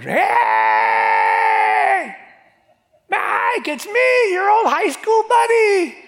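hammed-up parade heckler's holler, very high and stretched; screeching, drawn-out shout for attention; calling out. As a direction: yell to a friend far across the crowd. Imagined spectator hollering at passing parade float.

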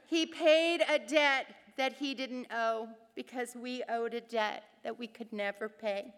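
normal talking voice

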